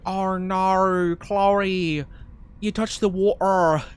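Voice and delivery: put-on mild, failed Australian accent parody. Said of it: "I can't do an Australian accent".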